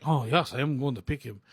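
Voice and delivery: a light attempt at a German accent; lightly voiced German scientist. Imitating Stanley Tucci's scientist in a mild German accent. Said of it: "that's my best German".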